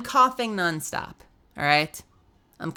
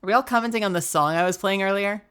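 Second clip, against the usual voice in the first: ordinary talk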